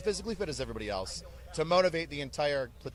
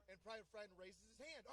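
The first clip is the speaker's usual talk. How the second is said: ordinary talk, nothing marked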